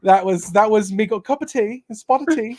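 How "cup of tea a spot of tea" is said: "Cup of tea, a spot of tea" in a hammed-up British accent, pitched higher, laughing; playful imitation of a British gent saying "spot of tea"; mock British accent. Host jokes about British guest.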